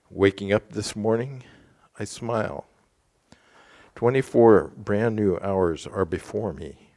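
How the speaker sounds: measured, emphatic reading of a vow; deliberate morning verse recitation. Real, not acted. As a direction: read it out firmly, pausing after each phrase. Reads a morning gatha aloud.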